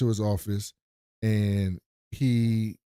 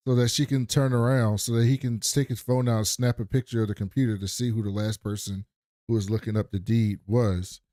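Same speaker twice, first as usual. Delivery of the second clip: ordinary talk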